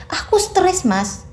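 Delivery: high outburst of anger; very angry. A narrator's reading. Naina furious at her husband, high angry outburst. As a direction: snap it out at him. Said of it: "dengan begitu marahnya".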